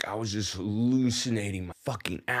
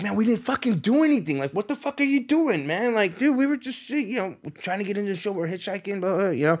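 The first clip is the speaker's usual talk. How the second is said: much higher, fast angry pleading; heated protest; 10 semitones higher than usual. Retold or imitated. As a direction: argue hard that they did nothing. Pleading with a bouncer.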